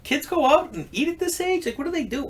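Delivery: high, incredulous disbelief; amazed. Retold or imitated. Watching polite kids at breakfast, amazed and high.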